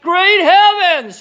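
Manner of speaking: high, loud, slow, stunned outcry; cried out. Acted. Mister Cranky seeing the giant hen, a high loud outcry. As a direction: cry it out in shock. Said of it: "he cried".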